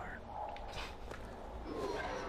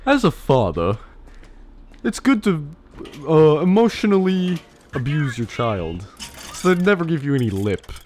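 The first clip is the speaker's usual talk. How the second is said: loud, higher-pitched lecturing father; mock parenting advisor; 3 semitones higher, 7 dB louder than usual. Joking dad giving parenting tips, mock-lecturing. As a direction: deliver fatherly advice like a loud show host. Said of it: "Chemo's parenting tips".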